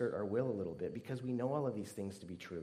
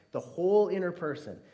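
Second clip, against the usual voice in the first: louder, higher, pressing on "whole"; emphatic repeat; 6 semitones higher, 9 dB louder than usual. Acted. Preacher pressing the point on the congregation, loud emphatic repeat. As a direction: proclaim it with force.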